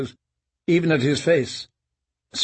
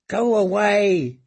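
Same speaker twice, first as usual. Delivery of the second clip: slow, higher frightened plea; higher, slow terrified prayer; desperate prayer; 7 semitones higher, slower than usual. Acted. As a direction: beg the footsteps to leave. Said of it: "he prayed".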